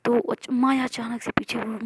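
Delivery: usual speaking voice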